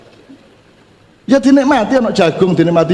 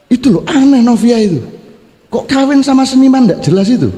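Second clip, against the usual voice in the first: mild mock gossip, pitched up; 6 semitones higher than usual. Son's friends sneering at mom's marriage, mock gossip. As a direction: tease like a nosy schoolmate.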